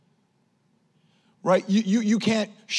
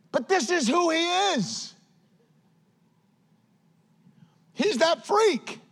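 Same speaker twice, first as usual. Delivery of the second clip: high-pitched, drawn-out preacher's exclamation; emphatic exclamation; 8 semitones higher than usual. Real, not acted. Preacher telling the church who John really is, emphatically exclaiming. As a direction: pitch it way up and proclaim it. Preacher's climax on John's oddness.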